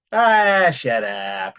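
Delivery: teasing, playful brush-off; joking. Shown as a demonstration. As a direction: laugh it off like a joke. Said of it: "you know, jokingly".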